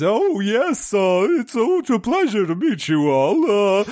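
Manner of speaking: high, dopey duck head voice; slack jawed dopey voice. Acted. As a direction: talk slack-jawed and dim, pitch up. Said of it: "the one with the dopey voice".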